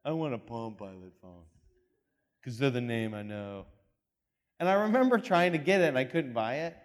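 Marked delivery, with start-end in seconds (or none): 0.0-3.7 lower, scoffing brush-off; mild mock scorn; dismissive younger self. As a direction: wave the iPhone away with a scoff. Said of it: "I was like".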